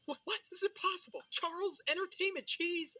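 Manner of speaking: put-on high, disbelieving kid excitement; freaking out. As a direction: squeal it like the best news ever. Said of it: "I'd start freaking out".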